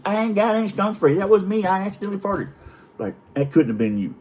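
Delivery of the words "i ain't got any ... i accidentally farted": high-pitched imitation of the wife; wife's confession. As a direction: own up in a high mock-wife voice. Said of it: "She goes".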